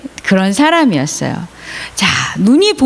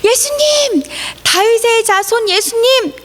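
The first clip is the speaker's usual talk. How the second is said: high, pleading acted cry; crying out loudly; 13 semitones higher than usual. Blind Bartimaeus begging Jesus for help, high cry. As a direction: plead in a high, stretched calling voice. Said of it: "아주 큰 소리로 바디메오가 소리를 지르기".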